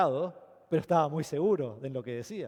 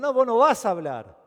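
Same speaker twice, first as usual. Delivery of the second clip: loud, higher shout of an angry mob; crowd shout; 6 semitones higher, 10 dB louder than usual. Retold or imitated. Ephesian crowd silencing Alejandro, loud angry shout. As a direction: shut him down with a loud yell.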